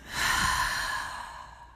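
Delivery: slow, audible exhale through the mouth; long breath out. Shown as a demonstration. Guide showing breathing out through the mouth, long exhale. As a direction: demonstrate a long, releasing out-breath. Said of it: "Out through the mouth".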